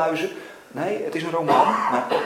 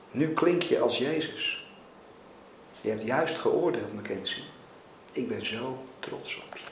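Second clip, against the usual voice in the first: tender reassurance; 3 semitones lower, 8 dB quieter than usual. A narrator's reading. God praising Mackenzie for his love, tender and quiet. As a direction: let it sink low and gently.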